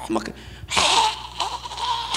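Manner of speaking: drawn-out high mock wailing cry. Pastor mocking mourners at the cremation, high drawn-out mock wail. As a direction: ham up a long high wail.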